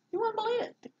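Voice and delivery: echoed in a high put-on voice. To viewers, echoing the locals in a high mock voice. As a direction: play the local disbelief, lightly voiced. Echoing accent remark, far voice.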